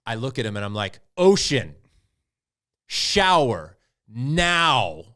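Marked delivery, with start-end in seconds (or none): 1.2-5.0 loud, higher, very slow barked order; stern yell. Retold or imitated. Fed-up father ordering his son to shower, loud stern yell. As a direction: drag out the order like a furious parent. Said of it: "like that".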